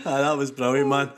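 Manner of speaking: ordinary talk, nothing marked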